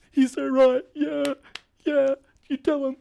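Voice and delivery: very high-pitched, loud cheering supporter; heckling crowd member. Pretend. Imagined audience member backing the comic, high put-on shout. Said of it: "I don't know who that is".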